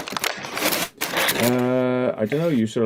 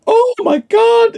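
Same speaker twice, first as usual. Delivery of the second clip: high, slow, loud mock yell of oh my God; a friend's overexcited cry, mimicked much higher and louder; imitating a shout; 9 dB louder, much slower than usual. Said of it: "stop shouting".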